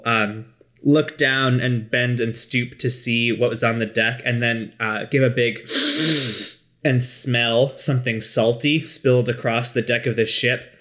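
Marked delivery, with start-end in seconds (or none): 5.6-6.5 a big deliberate sniff. Acted. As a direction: sniff the air hard.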